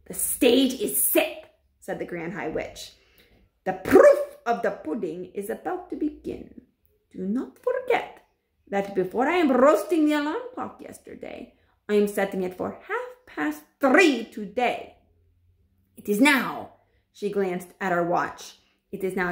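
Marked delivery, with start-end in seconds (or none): 0.1-1.4 put-on witch voice, gloating; menacing witch voice; Grand High Witch. 3.7-16.7 put-on witch voice, gloating; menacing witch voice; Grand High Witch.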